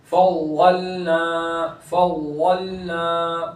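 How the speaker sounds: slow फव्वलना with a held heavy doubled ḍād; full heavy daad. Shown as a demonstration. Teacher shows ḍād's continuing sound, फव्वलना slow and full. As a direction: keep ल unbounced in फव्वलना and stretch ना. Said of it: "पुर भी करेंगे वाद को".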